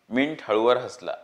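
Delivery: ordinary delivery, nothing marked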